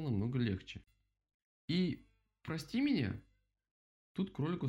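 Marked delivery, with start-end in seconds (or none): 1.7-3.2 effortful apology. A narrator's reading. Rabbit forces out an apology.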